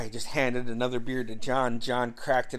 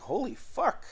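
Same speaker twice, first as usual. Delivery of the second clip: stunned exclamation; 11 semitones higher, 4 dB quieter than usual. Retold or imitated. Reacting to beer challenge.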